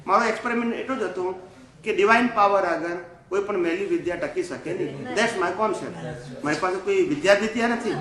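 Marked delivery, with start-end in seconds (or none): none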